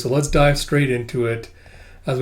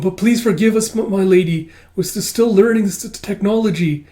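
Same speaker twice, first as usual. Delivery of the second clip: high, louder, stammering plea; apologetic underling; 7 semitones higher, 4 dB louder than usual. Acted. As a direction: stumble through the apology in a raised voice. Beast nervously begs my lady's forgiveness.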